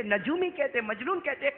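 usual speaking voice

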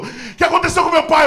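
high, loud mock alarm; panicked father. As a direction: cry out the question in acted panic. Father panics seeing mother cry.

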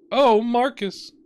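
high, slow, swooning sigh of Oh, Marcus; dramatic lovestruck princess. Acted. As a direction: ham up a stage lover's longing. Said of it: "you're doing great".

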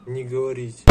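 ordinary talk, nothing marked

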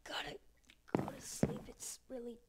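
very soft, half-whispered; fading into a whisper; hushed murmur. Pretend. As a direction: murmur it quietly to yourself. Murmuring in the dark before intro.

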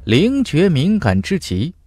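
ordinary delivery

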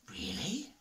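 soft, hushed doubtful question; whispered. Acted. The animals doubt Badger, whispering. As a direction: breathe it out in disbelief. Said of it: "whispered the other animals".

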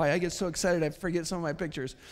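ordinary talk

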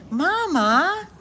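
excited high squeal. Real, not acted. Owner squealing as her dog keeps pushing closer. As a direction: squeal at the dog crowding you.

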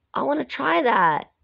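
high eager shout without real volume; a child's keen call. A narrator's reading. Mowgli calling out eagerly, mild mock shout. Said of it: "Mowgli shouted".

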